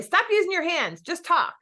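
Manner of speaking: high, sharp imitation of mom scolding; mock nagging mom. Daughter imitates mom scolding her hand gestures. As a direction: play the mom telling her kid off.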